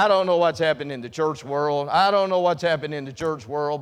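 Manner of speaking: ordinary talk, nothing marked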